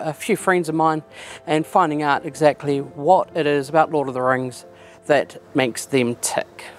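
ordinary delivery

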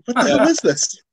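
high, disbelieving outburst; baffled what-the-hell cry; incredulous. Retold or imitated. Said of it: "I'm like".

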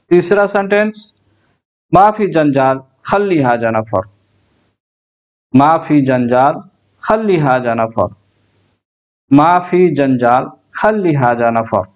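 normal talking voice

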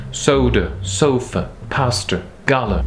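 soda, sofa, pastor, gala, each stressed at the start; stress on first syllable. Shown as a demonstration. Teacher giving English word examples, first syllables stressed. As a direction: lean on SO, SO, PAS, GA, endings weak. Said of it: "Première syllabe".

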